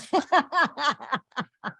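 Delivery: high, loud run of laughter; hearty laugh. Real, not acted. Co-host laughing off the banter, hearty laugh.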